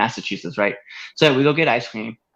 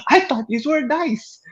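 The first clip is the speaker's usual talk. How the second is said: high, naive whine imitating the lady; mock dim lady; 12 semitones higher than usual. Mocking the dolphin lady in a high naive voice. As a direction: play the clueless lady, pitched way up. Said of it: "She's like".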